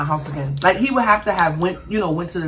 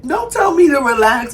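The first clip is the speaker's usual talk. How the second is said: raised, higher and louder don't tell me to relax; firm warning; 7 semitones higher, 5 dB louder than usual. Acted. Mother warning her kid never to say relax, raised and firm. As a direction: lay down the rule with a raised voice.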